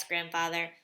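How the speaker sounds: plain reading voice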